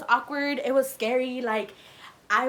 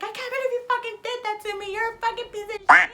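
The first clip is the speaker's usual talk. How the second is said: high-pitched indignant tirade, louder at the end; imitated angry rant; 11 semitones higher, 8 dB louder than usual. Girlfriend picks up his call, imitated high angry rant. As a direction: mimic her telling him off.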